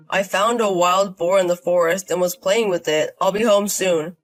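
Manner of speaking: easygoing man calling in; husband on the phone. Acted. Dylan phones his wife from the forest, easygoing.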